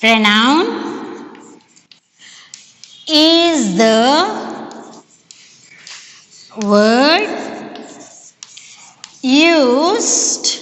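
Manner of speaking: word by word, long pauses between; dictation. Real, not acted. Dictating a definition for writing.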